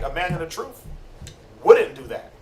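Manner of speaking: ordinary delivery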